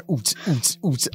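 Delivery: soft dance-beat chant; hushed mock "oots" beat; party beat. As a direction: mimic club music quietly. Taste buds dance party.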